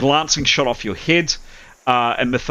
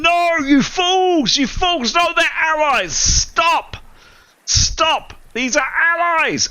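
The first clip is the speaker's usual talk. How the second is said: Gildar yelling; much slower than usual. Acted. Archer yelling at the tower to stop firing, frantic high yell. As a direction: shout them down to stop firing. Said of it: "Gildar is yelling".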